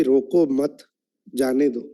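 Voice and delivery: "रोको मत" as one phrase, then a separate "जाने दो"; pause after मत. Shown as a demonstration. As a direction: break after मत, dropping on जाने दो. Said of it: "कि उनको जाने दो आप". ("रोको मत" साथ में, फिर विराम)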